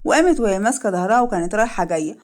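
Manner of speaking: ordinary delivery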